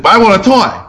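imitated child insisting, high-pitched; insistent child. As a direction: mimic a high, whiny kid protesting. Retold child insisting after refusal.

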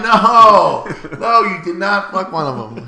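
loud, high, laughing no; hearing the roommate slept with her, loud laughing denial; shocked laughing denial. Retold or imitated. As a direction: refuse to believe it.

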